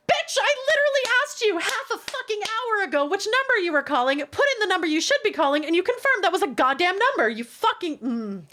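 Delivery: high, exasperated retold rant; mock furious rant. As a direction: vent the fury like it's happening again. Angry retold rant at travel agent.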